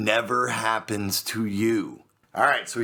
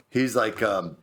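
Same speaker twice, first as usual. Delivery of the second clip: ordinary delivery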